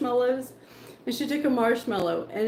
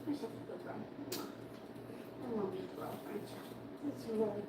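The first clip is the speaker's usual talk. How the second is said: soft whispered praise, much softer; 19 dB quieter than usual. Real, not acted. Owner whispering praise to her dog. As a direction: hush it right down, tender.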